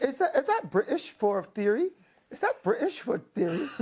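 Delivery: sneering imitation of a British accent; mock British accent. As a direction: tease the host in a put-on British accent. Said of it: "Why are you making fun of his accent?".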